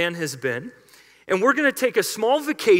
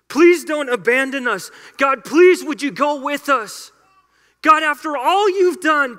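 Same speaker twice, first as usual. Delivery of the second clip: pleading louder and higher, voice straining; begging; 4 semitones higher, 4 dB louder than usual. Acted. Moses begging God for his people, pleading loudly. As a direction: cry out to God as a desperate leader.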